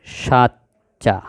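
সাচ্চা drawn out very slowly, syllable by syllable; slow classroom demo of সাচ্চা. Shown as a demonstration. Teacher sounds out conjunct word.

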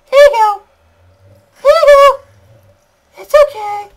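very high, loud falsetto cooing; squeaky baby-talk. Pretend. Player handing things to the game baby, squeaky baby-talk.

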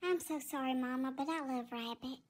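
low, soft worried pet voice; timid cat Tigger. Acted. Tigger apologizes.

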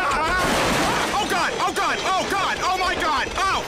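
high, loud cries of panic; panicked screaming. Acted. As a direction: yell in terror as it goes down. Peter copter crashing into yard.

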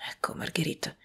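hushed whisper. A narrator's reading. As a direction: whisper her name before confessing. Intimate confession to her.

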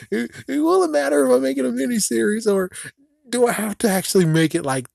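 giddy squeak. Real, not acted. Vlogger wondering how many episodes trigger the curse, giddy high rush. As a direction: rattle it off high and breathless with glee. Giggly rapid rant.

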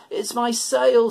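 a distressed goat calling out. A narrator's reading. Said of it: "said the goat".

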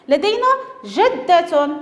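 ordinary delivery

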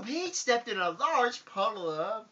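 ordinary delivery, nothing marked

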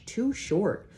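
usual speaking voice

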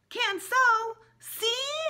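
put-on high, loud lisping little boy; toddler voice. A narrator's reading. Fudge showing off his teeth to the dentist in a high toddler voice. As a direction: squeal it like a proud toddler.

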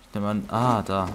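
ordinary talk, nothing marked

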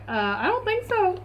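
high mock-indignant dog voice; voicing the dog. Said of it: "Lulu would be like".

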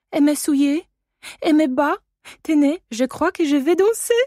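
put-on high, loud, chirpy child's voice; French little girl. A narrator's reading. Adèle asking her guardian about her dress, high and eager. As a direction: show off the new dress to him. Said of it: "zawołała".

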